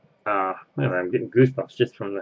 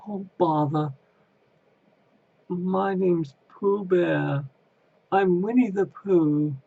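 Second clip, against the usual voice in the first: Winnie the Pooh impression; 8 semitones higher, slower than usual. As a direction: try for the gentle Pooh Bear voice. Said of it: "I'll try to do it".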